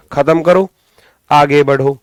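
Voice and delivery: ordinary delivery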